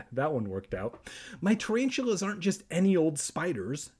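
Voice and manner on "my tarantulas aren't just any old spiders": high-pitched indignant protest; a touch of pique; mild fuming. A narrator's reading. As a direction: object in a huffy, raised pitch. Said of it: "Kermit fumed".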